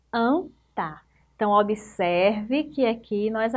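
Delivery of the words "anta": "Anta" as two parts, an, pause, ta; split into syllables. Shown as a demonstration. Teacher showing pupils the syllables of "Anta", split apart. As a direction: break "Anta" after "An" with a clear gap. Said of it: "Separando as sílabas".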